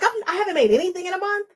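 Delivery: high, fast retold panic; imitating her own past panic, high and quick; mock panic. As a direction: rattle it off in a high voice like you're panicking. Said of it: "I was like".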